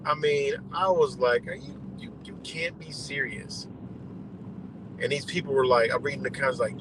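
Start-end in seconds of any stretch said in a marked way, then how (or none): 1.5-3.7 whispered, much softer disbelief; stunned whisper. Real, not acted. Reading truther nonsense, a whispered stunned disbelief. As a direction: breathe it out in disbelief.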